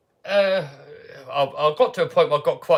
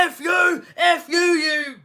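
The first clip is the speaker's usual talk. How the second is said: imitating a collector screaming abuse, much higher; furious swearing; 13 semitones higher, much slower than usual. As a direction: mimic the caller losing it, shouting. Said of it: "He was getting so mad".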